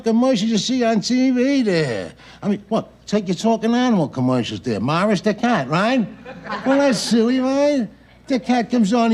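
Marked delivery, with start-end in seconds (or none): none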